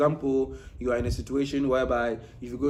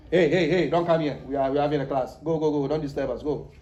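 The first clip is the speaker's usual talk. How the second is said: louder, brisk shooing-away calls; mock shooing; 3 dB louder than usual. Teacher imitating someone shooing a woman out of class, mock loud. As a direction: chase her out of the room like an annoyed gatekeeper.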